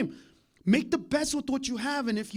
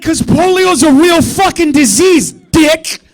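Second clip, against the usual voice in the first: loud, slow, shouted comeback; retold loud retort; 6 semitones higher, 18 dB louder, slower than usual. Comic retelling his shouted retort to his friend. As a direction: yell it back at the friend. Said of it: "And I'm like".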